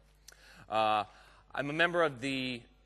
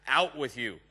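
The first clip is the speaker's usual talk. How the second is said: playfully booted out, louder; mock eviction; 7 dB louder than usual. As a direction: jokingly order the admin out of the room. Joking at admin hiding in back.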